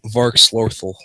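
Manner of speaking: usual speaking voice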